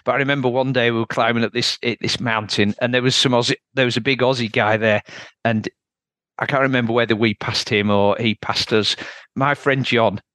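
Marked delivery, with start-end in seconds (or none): none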